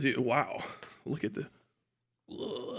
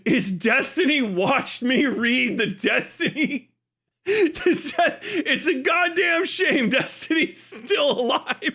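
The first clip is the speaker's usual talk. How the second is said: laughing while reading; 5 semitones higher, slower than usual. Real, not acted. Reading a funny copypasta about himself.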